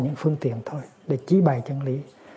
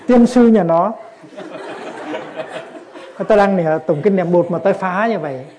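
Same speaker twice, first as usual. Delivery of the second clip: much louder and higher, laughing through it; angry old woman cursing the neighbour who interrupted her prayers; mock furious curse; 4 semitones higher, 5 dB louder than usual. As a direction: curse him out, half laughing.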